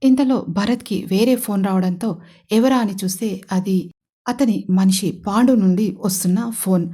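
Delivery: ordinary delivery, nothing marked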